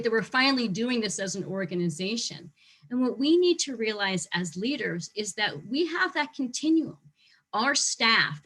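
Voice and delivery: ordinary talk, nothing marked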